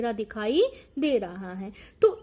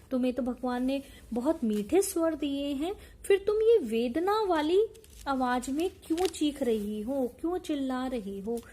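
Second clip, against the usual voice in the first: ordinary talk, nothing marked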